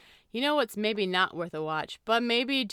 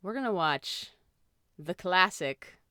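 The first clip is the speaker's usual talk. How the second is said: halting announcement with long pauses; slow, drawn-out film reveal; suspenseful reveal; slower than usual. Real, not acted. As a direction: dole out the title bit by bit, stretching the last words.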